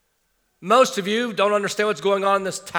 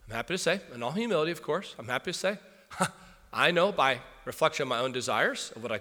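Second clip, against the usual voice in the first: softer, hesitant, mildly smug voice; self-satisfied churchgoer; 4 semitones lower, 8 dB quieter than usual. Retold or imitated. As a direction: play a hopeful, modest-boasting churchgoer. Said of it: "sounds like the following".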